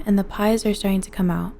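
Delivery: plain reading voice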